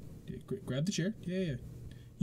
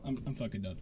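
lower, softer, beaten-down quitting; defeated mutter; 11 semitones lower, 8 dB quieter, faster than usual. Real, not acted. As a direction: mumble it, all fight gone. Defeated after the rage.

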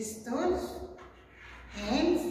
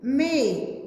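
plain reading voice

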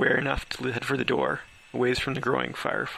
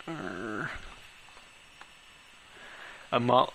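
ordinary delivery

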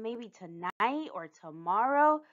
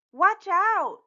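high, loud warning shout; startled, high-pitched "look out" cry; 9 semitones higher, 5 dB louder than usual. Acted. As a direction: call out sharply to warn a little sister. Sister warns after a crash.

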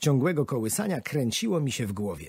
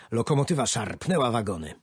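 ordinary delivery, nothing marked

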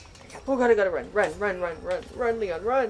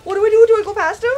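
high, loud panicked yelling; frantic cries breaking into a squeal; scared; 9 semitones higher, 8 dB louder than usual. Real, not acted. Player trying to get past an enemy, scared yelling. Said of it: "I'm so scared".

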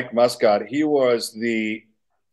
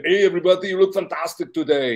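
put-on higher, Austrian-accented Arnold greeting; Arnold impression; 7 semitones higher than usual. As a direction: boom out hello like Arnold at the gym. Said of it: "let's hear your Arnold impersonation".

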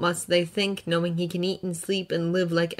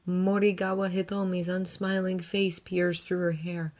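ordinary delivery, nothing marked

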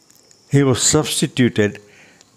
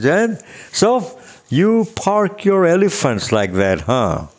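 higher, playful ribbing; teasing; 7 semitones higher than usual. Retold or imitated. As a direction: poke fun at an old friend. Teasing friend years later.

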